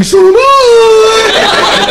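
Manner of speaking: very high, delighted cry; gleeful shout. Pretend. Comic matching the caller's pitch, gleefully high. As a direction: pounce with mad glee. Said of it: "get fucking weird".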